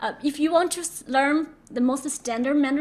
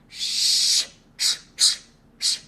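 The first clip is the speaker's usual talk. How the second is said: forceful voiceless shush, repeated; slower than usual. Shown as a demonstration. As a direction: shush someone with long then short hisses. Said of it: "it's like you shush someone".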